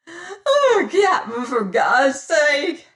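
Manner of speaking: high, loud, sobbing plea; begging in terror. Acted. Pirate begging the captain for God's sake, sobbing terror. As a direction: plead desperately for your life. Man begs for his life.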